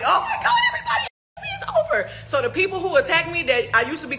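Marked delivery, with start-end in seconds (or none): 0.0-2.1 shrieking high mock wail through laughter; laughing parody of her old crying self; mock despair. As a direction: ham up a melodramatic meltdown, way up high. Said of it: "and I'd be like".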